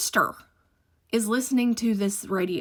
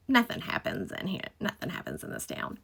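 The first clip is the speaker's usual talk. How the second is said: soft, low mock of the sleepy town, ending in a whisper; hushed mock; 5 semitones lower, 12 dB quieter than usual. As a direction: drop to a whisper as if nothing ever happens. Mocking the sleepy small town.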